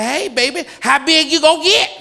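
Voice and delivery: put-on high, loud sugary old-lady voice; mock sweet old lady. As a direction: sweetly tease about weight.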